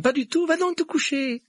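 high, loud bossy character voice; bossy girl. A narrator's reading. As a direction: order him off in a high, insistent voice. Hermione sends Neville to bed.

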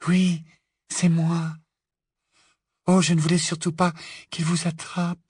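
put-on high, light, gentle girl's voice; Luna, gentle and dreamy. A narrator's reading. Luna greeting captured friends in the cellar, gentle dreaminess.

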